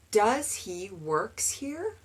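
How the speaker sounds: slow, careful mock error; learner's mistake. Shown as a demonstration. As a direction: drag it out like a confused student. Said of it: "They want to conjugate both verbs".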